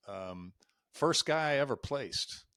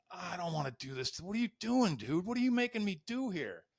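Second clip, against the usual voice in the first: panicked inner plea; 9 semitones higher than usual. Retold or imitated. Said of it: "I'm like".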